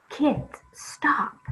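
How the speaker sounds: high, loud storybook yell; teacher yells. A narrator's reading. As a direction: shout it out like a cross teacher. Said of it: "The teacher yells".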